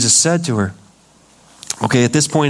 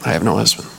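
ashamed, sad; 8 semitones lower, much faster than usual. Shown as a demonstration. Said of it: "Think she said it like that?".

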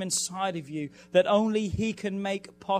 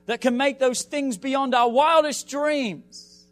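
preaching at full force, higher and louder; 4 semitones higher, 4 dB louder than usual. Acted. Pastor urging the congregation before the fast, full-force preaching. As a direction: drive it home like a revival preacher, rising.

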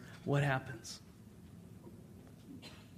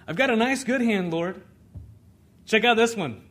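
proud show-off; 7 semitones higher, 5 dB louder than usual. Retold or imitated. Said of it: "showing off the good hand".

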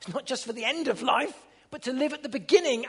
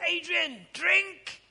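a hint of an insistent bishop calling out; mock urging, higher; Bishop Sadir; 8 semitones higher, much slower than usual. Pastor quoting his bishop, insistent higher call. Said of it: "I can't do his Indian accent".

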